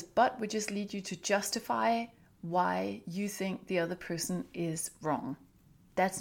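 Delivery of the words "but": "but" punched high and loud; stress on but. Shown as a demonstration. Coach warning parents, "but" stressed high and loud. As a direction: hit the word "but" hard and high.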